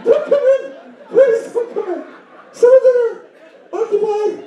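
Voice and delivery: very high, squeaky falsetto panic; panicked screaming. Acted. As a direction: squeal in mock terror at the intruder. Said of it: "You start screaming".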